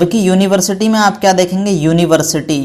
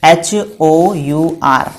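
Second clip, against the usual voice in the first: slow spelling; much slower than usual. Shown as a demonstration. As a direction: spell each letter slowly. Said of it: "मैंने लिखा".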